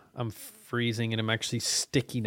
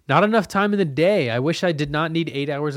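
much higher and louder exasperated cry; frustrated outburst; 4 semitones higher, 6 dB louder than usual. Real, not acted. Complaining to listeners about his job, loud outburst. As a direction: let the frustration burst out. Venting about having no time.

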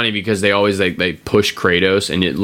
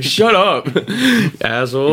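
mock snap; 10 semitones higher than usual. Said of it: "It's like".

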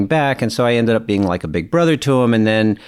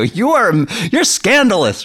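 high-pitched imitation of a tickled boy; amused mock outrage; 11 semitones higher than usual. Boy busting up at dad's joke, amused mock outrage. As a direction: exclaim it high, as if scandalized and amused. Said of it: "he just busted off laughing".

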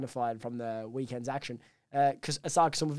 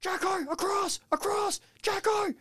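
high-pitched on-field yelling, words stretched out; Ryan Veach impression; slower than usual. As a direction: call out urgently to a teammate across the pitch. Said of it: "That was a great impression from me".

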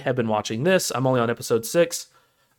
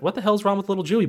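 higher, fast blurted quote; rushed higher-pitched mock voice; quick mock character voice; 7 semitones higher, faster than usual. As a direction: toss the line off bluntly in character. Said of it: "she's like".

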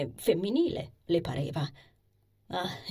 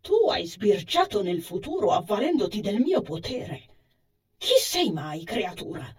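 openly surprised, with lively curiosity; surprised, curious; 4 dB louder than usual. A narrator's reading. Sacred animal speaking to Anisa about the future, surprised and curious. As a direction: question it in curious astonishment, voice slightly raised. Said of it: "In un tono che non nascondeva la sorpresa, frammista ad una viva curiosità".

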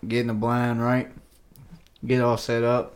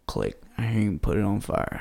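ordinary talk

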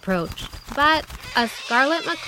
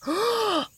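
delighted gasp; 12 semitones higher, 4 dB quieter than usual. Acted. Porcupine sees the plant.

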